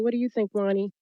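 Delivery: normal talking voice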